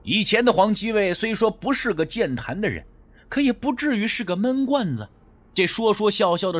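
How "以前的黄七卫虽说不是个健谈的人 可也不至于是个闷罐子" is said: usual speaking voice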